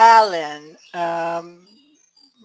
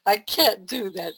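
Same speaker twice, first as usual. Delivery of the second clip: ordinary talk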